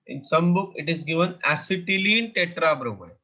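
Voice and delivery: normal talking voice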